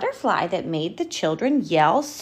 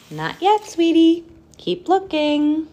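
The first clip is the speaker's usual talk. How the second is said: gentle mother; 10 semitones higher, 5 dB louder than usual. Acted. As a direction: soothe the little one. Mother bat soothing.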